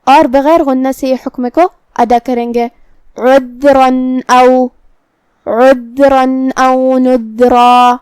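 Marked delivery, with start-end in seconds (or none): 3.2-7.9 slow chant, عذرا ending in a plain n before او; clear tanween. Shown as a demonstration. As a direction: sound the n of عذرا clearly without a hum, then او. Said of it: "تنوین ظاہر کریں گے اور اسے نون ساکن".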